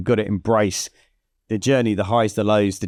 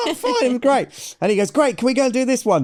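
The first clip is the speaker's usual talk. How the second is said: excited. Retold or imitated. Acted post-ride exchange, high voice.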